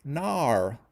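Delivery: drawn-out Aussie "naur" for no; mock Australian accent. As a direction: tease the Australian way of saying no. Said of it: "when you say no, you say".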